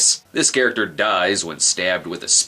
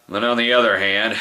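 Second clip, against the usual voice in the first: usual speaking voice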